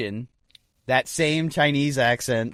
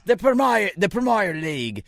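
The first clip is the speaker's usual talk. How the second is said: higher-pitched mock British sports pundit; British talking head; 7 semitones higher than usual. Co-host imitating a BBC sports pundit, mock British accent. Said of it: "some British talking head".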